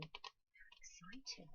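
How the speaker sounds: slow breathy whisper of delight; whispered aside. Acted. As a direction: hush it like a shared secret. Aside to children about toys.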